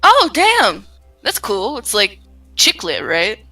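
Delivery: ordinary talk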